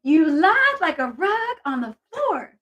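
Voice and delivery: high, slow teasing scold; singsong mock outrage at a friend; mock accusation. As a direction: playfully call her a liar, stretching the last line. Teasing a chat member who guessed wrong.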